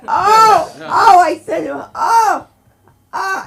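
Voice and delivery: high loud crying oh, half laughing; wailing oh. Retold or imitated. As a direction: moan oh over and over at him. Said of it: "I just kept saying it".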